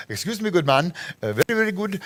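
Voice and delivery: put-on a fuller, playful Indian accent on the retry; mock Indian accent. A tourist asks Raul for advice, in a lightly mocked Indian accent. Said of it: "I can't do an Indian accent".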